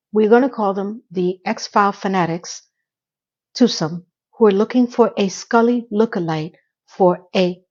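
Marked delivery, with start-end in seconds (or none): none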